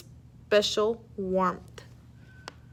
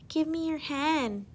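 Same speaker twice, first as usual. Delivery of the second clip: put-on higher, slow little kid voice; grinning child; 6 semitones higher, slower than usual. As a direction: ask with a cheeky grin.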